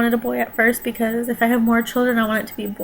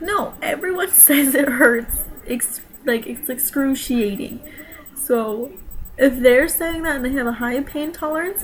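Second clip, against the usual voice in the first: usual speaking voice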